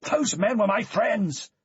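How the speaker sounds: loud, high, slow furious shout; angry raw shout; raw anger. A narrator's reading. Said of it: "his voice raw with anger".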